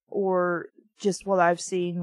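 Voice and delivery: ordinary talk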